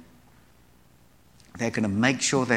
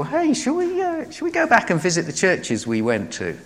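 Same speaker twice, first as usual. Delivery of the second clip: cheery Barnabas; 7 semitones higher than usual. Retold or imitated. Barnabas suggests trip to Paul.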